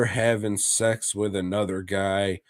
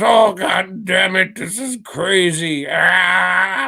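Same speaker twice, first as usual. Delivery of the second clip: higher and louder, shouted mock outrage; hammed-up shocked husband, shouting higher; mock shocked yell; 9 semitones higher, 7 dB louder than usual. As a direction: yell it like a husband surprised at home. Husband shocked by the cat party.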